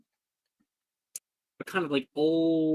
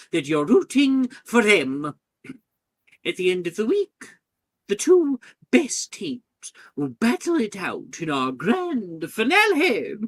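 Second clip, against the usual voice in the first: higher, louder headmistress making an announcement; 7 semitones higher, 7 dB louder than usual. Acted. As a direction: project to the whole hall as headmistress.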